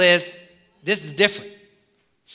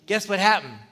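ordinary talk

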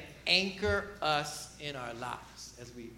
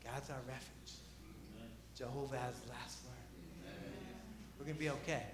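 reassuring; 6 semitones lower, 18 dB quieter than usual. Real, not acted. Quiet reassurance after the Selah pause.

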